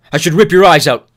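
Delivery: loud, high snarl of rage; savage threat. Acted. Newt pinning Thomas down, savage loud threat. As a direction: threaten him with fury.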